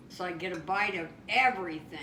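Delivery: ordinary delivery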